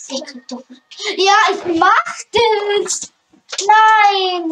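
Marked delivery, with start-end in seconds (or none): 1.2-4.5 frantic shouting. Real, not acted.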